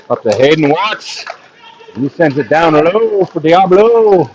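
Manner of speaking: steady running hockey commentary; live play-by-play. Acted. Commentator calling the game for stream viewers, live play-by-play. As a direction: call the puck movement as it happens.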